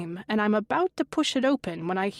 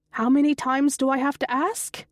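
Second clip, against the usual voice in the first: exasperated; 5 semitones higher, 4 dB louder than usual. Acted. Exasperated mother repeating request.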